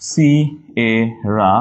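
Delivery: very slow "Sierra" as si, e, ra; syllable by syllable. Shown as a demonstration. Teacher showing spelling, "Sierra" slowly split. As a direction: leave gaps inside "Sierra". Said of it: "একটু ভেঙে মনে থাকবে".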